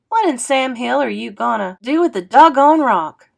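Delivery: loud, exasperated outburst, high and loud. A narrator's reading. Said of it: "It sounded a lot meaner than I wanted it to".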